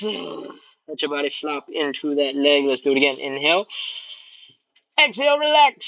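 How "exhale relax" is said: straining mid-stretch. Real, not acted. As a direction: breathe it out through the effort. Coach folding along with class.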